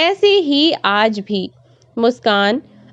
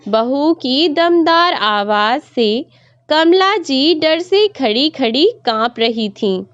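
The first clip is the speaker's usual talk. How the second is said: plain reading voice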